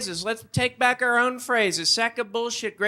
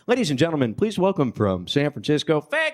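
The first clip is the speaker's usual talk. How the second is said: Texas emcee; 5 semitones lower than usual. Retold or imitated. Texas emcee introducing comic, mock announcer voice. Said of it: "they'd bring me up".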